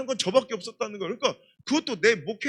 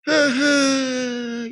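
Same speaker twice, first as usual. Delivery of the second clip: drawn-out sly chuckle; 3 semitones higher, 8 dB louder, much slower than usual. Retold or imitated. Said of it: "그때".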